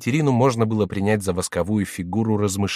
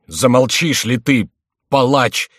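loud, slow tearful scolding; tearful outburst; 3 semitones higher, 6 dB louder, slower than usual. A narrator's reading. King scolding the jester with tears in his eyes. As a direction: snap at him through tears. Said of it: "вскричал Генрих со слезами".

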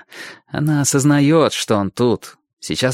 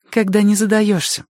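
put-on girl's voice; 10 semitones higher than usual. Girl voiced in dialogue.

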